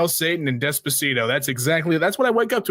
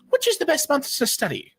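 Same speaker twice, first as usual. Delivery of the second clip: high questioning voice; 11 semitones higher than usual. Acted. High quizzing voice.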